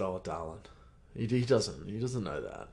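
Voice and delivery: soft, reassuring dad voice; tender comfort. Retold or imitated. Dad softly comforting his upset son. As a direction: hush it gently to a hurt child.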